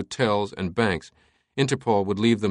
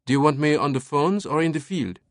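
put-on higher French-accented subordinate; dutiful lieutenant; 6 semitones higher than usual. A narrator's reading. Lieutenant asking his captain for an assignment, dutiful. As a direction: ask for orders in a higher voice.

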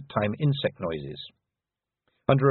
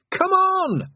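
very high, loud scream to hurry; desperate yell; 5 dB louder than usual. A narrator's reading. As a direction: scream for them to run. Yelling as the wave hits.

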